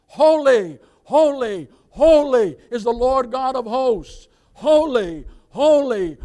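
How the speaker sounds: loud, slow proclaiming chant; shouting. Acted. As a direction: shout it over and over like the seraphim. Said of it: "That they're shouting".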